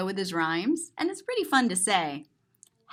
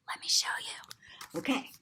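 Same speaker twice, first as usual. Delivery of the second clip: secretive whisper; hushed unvoiced aside; 2 semitones lower, 9 dB quieter than usual. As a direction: lean in and whisper.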